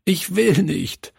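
put-on high, pleading child's wail; acted tearful refusal; sobbing protest. A narrator's reading. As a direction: protest like a child close to tears, voice pitched high. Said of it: "schluchzte er".

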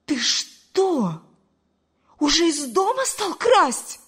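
high, loud, outraged question; loud, scandalised mother's cry; indignant outburst. A narrator's reading. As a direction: draw out the shocked accusation, voice rising high. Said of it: "возмутилась Мариам Садыковна".

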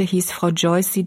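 ordinary delivery

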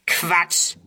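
short, scornful dismissal; jeering. A narrator's reading. Third youth dismisses Ernst's reason, jeering. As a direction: toss it out with scorn. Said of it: "höhnt ein dritter".

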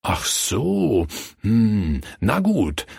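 pondering storyteller's musing; thoughtful. A narrator's reading. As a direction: mull it over, then give in. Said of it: "sagte der Reiter nachdenklich".

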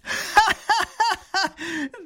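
high, loud booming jeering laugh; robber's laughter. Acted. Robber laughs at the grand duke's demand. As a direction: belly laugh of a gloating robber. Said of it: "Gelächter des Räubers".

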